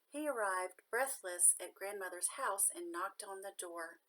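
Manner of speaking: plain reading voice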